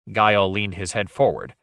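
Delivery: plain reading voice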